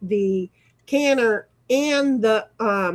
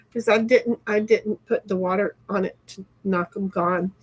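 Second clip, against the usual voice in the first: ordinary talk, nothing marked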